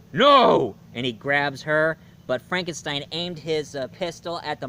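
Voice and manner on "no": loud sudden no; monster's shout. Retold or imitated. Monster stops the bride from saying Victor, loud shout. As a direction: bark the no as the monster lunges. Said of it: "the monster was like".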